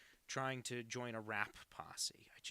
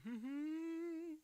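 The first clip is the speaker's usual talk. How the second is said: high laugh. Real, not acted. Loud laugh reading chat.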